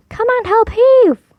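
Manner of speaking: high, loud call for help. Acted. Husband calling his wife over to pull. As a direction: strain out a call to heave. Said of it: "called the man to his wife".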